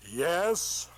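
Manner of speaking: a hint of a booming God voice; voice from above. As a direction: answer calmly from the heavens. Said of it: "this voice says".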